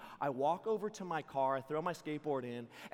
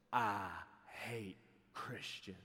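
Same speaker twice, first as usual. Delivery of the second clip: low, very slow, quiet threat; hushed, drawn-out hostility; menacing confrontation; 8 semitones lower, 7 dB quieter, much slower than usual. Retold or imitated. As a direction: say it slowly and low, right in his face. Mohawk man's menacing confrontation.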